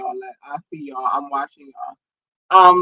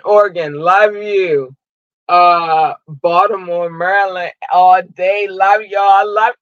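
usual speaking voice

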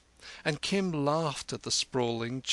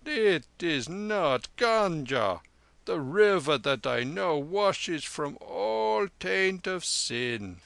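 high, slow, drawn-out lama voice; solemn lama teaching; 6 semitones higher than usual. Acted. Lama urging the soldier onto the middle way, solemn and slow. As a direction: preach slowly in a raised, lilting voice.

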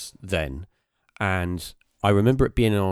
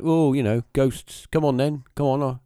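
sarcastic, raised voice taunting ghosts; mocking skeptic; 6 semitones higher than usual. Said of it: "his attitude was like".

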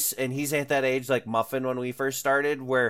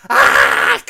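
low, loud chomping roar; loud biting growl; 11 semitones lower, 13 dB louder than usual. Retold or imitated. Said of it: "he just bit his dice like".

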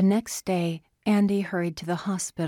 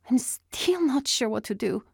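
higher, wavering and unsure; 7 semitones higher than usual. Acted. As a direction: let the voice waver. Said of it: "Anna's voice wavered".

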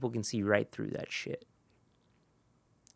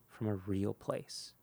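ordinary delivery

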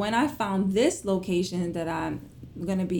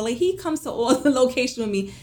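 usual speaking voice

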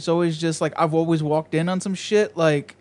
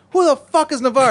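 higher, louder gruff yell through a door; mock dealer yell; 10 semitones higher, 7 dB louder than usual. Dealer yelling at a knock on his door, a mock loud shout. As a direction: bark it like a suspicious dealer behind the door.

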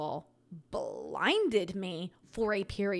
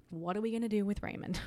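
normal talking voice

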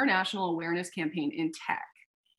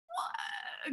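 breathless disbelieving what, much higher; stunned what; 11 dB quieter than usual. Retold or imitated. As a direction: gasp out the word in shock. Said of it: "And we're like".

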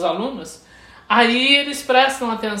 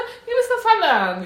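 put-on high-pitched Valley Girl lilt; imitated Valley Girl drawn-up tone; Valley Girl accent; 9 semitones higher than usual. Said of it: "sotaque de Valley Girl que ela tem".

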